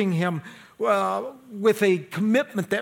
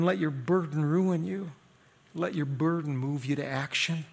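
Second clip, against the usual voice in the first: usual speaking voice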